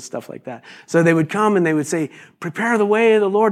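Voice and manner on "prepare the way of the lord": higher, ringing herald call; herald's proclamation. Retold or imitated. Herald calling to the town, proclaiming high.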